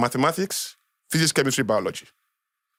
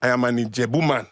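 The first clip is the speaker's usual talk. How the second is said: ordinary delivery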